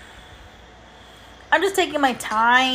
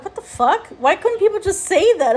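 higher, incredulous what-the-fuck; mock exasperated rant; retold baffled outburst; 6 semitones higher than usual. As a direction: replay the moment of disbelief, pitched up. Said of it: "And I'm like".

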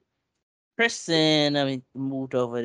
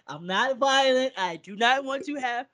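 mock calm reassurance; 9 semitones higher than usual. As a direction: insist you're not violent, laughing. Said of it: "I'm like".

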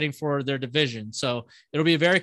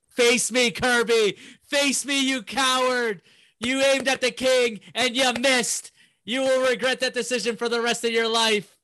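villain speech; 8 semitones higher than usual. Acted. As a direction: taunt the rival like a wrestling heel. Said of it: "that was a villain speech right there".

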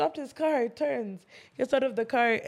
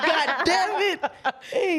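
laughing; 5 semitones higher, much slower than usual. Real, not acted. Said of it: "cursing lesson one oh one".

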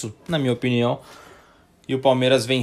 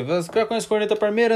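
friend voice; 10 semitones higher, faster than usual. Acted. Acted friend asking about podcast.

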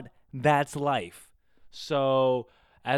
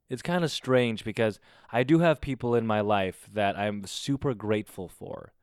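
normal talking voice

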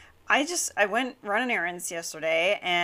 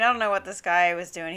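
ordinary delivery

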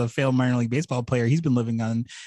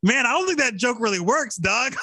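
high, incredulous character voice; high jokey brush-off to a bad joke; mock comeback; 11 semitones higher than usual. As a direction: pitch it way up like a disbelieving game character. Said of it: "And the response is".

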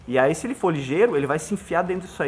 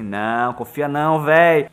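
brain's voice, louder; 7 dB louder, slower than usual. Retold or imitated. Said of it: "Seu cérebro fala assim".

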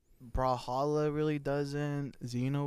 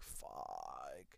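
very low, hushed curse; mock-defeated, barely voiced; muttered retold dismay; 14 semitones lower, 16 dB quieter than usual. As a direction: sink into a deep, soft groan of a word. Retold dread over homework.

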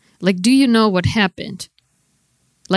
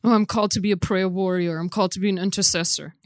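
mocking; 4 semitones lower, 6 dB quieter, faster than usual. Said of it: "all this spiritual nonsense".

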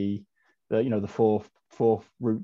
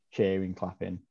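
ordinary talk

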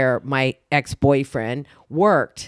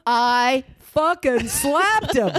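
slow, punched and higher, savoring it; emphatic; 8 semitones higher, much slower than usual. Real, not acted. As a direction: lean hard on slapped, proud of it. Proudly repeating the slap.

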